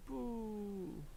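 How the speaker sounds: soft, high drawn-out "boo"; imitated zoom noise. Streamer narrating where he zooms around, soft imitated noise. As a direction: voice a quiet, high sound effect.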